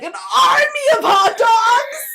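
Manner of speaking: high, loud, slow laughing shout; a loud, high, drawn-out shout through laughter; villain shout. Acted. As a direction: announce it like a cartoon villain. Valentine's villain announcement.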